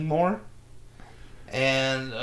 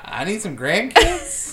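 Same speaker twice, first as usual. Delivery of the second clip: higher, slow pushy Boston mother; Boston mom impression; 6 semitones higher, 7 dB louder, slower than usual. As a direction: nag like a mom who wants grandkids. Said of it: "That's a pretty good Boston mom".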